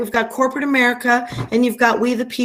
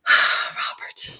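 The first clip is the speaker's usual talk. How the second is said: sighed name; 4 dB quieter than usual. Real, not acted. Sighing name to friend.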